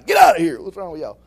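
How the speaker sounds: put-on sudden loud cranky snap like an overtired toddler; a grumpy overtired outburst. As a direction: bark it out like a tired kid.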